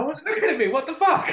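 high, loud, laughing mimicry of a sulking friend; laughing mock whine. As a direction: squeal it through laughter, mocking him. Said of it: "teasing mimic of friend".

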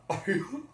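high, silly cartoon chuckle; Goofy laugh. Imitating Pippo's famous laugh, high goofy chuckle. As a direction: give a dopey rising cartoon giggle. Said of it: "so fare solo la sua risata".

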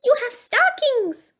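very high mouse squeal; excited cry. A narrator's reading. Mouse points at the mantel, squealing with excitement. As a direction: squeal with delight as a little mouse. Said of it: "he cried".

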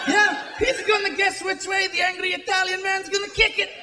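put-on unplaced European accent, building to a shout of triumph; mock soccer fan accent. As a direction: cheer like a hopeful fanatic in a vague accent. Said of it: "This is the sound of soccer fans".

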